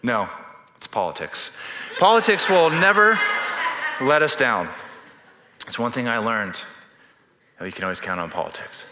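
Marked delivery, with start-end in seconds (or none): 2.0-4.7 ironic punchline. Acted.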